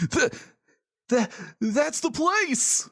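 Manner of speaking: high, drawn-out character voice; Johnny. Acted. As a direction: pitch it up and stretch the words. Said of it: "You had a voice for him".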